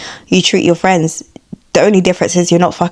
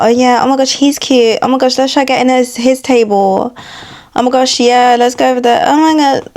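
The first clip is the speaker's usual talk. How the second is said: higher, mock-giddy girly chatter; boy-crazy girl; 4 semitones higher than usual. Girl plotting with friends over a cute man, mock giddy. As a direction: mimic an excitable girl chasing men. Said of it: "even just speaking like that traumatizes me".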